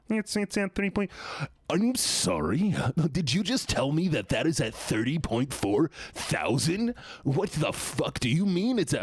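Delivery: high, slow cartoon alien voice. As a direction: rant in the Martian voice. Disbelief at view count, cartoon voice.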